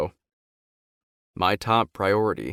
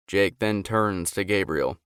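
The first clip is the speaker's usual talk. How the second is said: plain reading voice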